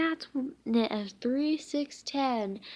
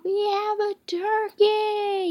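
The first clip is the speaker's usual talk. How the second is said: much higher, loud, stretched announcer cry; excited game show shout; yelled; 11 semitones higher, 7 dB louder than usual. A narrator's reading. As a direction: crow the big news to the crowd. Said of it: "yelled the guy".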